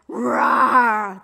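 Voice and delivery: loud, growling roar; lion's roar. Acted. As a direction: roar like a lion in a fight. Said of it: "The lion roared".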